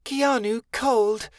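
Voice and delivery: put-on slow, high, simple childlike voice; simple little fairy voice. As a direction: state it plainly like a small child. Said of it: "Keanu stated simply".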